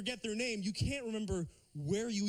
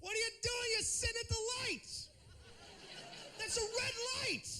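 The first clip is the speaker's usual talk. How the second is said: much higher mock outraged driver; mock indignant driver; 3 dB quieter than usual. Driver mocking the guy at the red light, high and outraged. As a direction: pitch way up, playing outraged at the man. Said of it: "I'm like".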